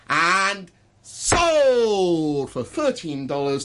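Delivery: slow, stretched hammer call; very slow final sold; drawn-out sold. Shown as a demonstration. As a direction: land the sale slowly. Auctioneer closes the sale.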